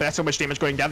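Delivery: ordinary delivery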